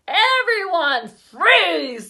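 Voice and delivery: loud, slow, firm command. A narrator's reading. Dad halting the chaos, loud slow command. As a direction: stop everyone in their tracks. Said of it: "Dad said".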